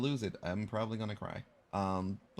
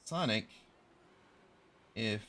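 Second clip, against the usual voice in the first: ordinary talk, nothing marked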